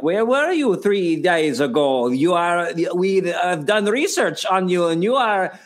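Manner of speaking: hammed-up German Gestapo interrogator, drawn-out words; slow, accusing mock-German accent; terrible German accent. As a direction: question a prisoner in a badly faked German accent. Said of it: "you have a terrible German accent".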